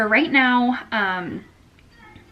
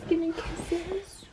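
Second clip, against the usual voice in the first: slow, breathy whisper asking for kisses; 8 semitones higher, 8 dB quieter, much slower than usual. Real, not acted. Whispered request to the toddler.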